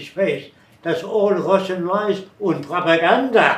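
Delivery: put-on loud, high German accent; imitating an old German immigrant; loud German accent scoffing at the Russians. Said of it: "and I imitated them".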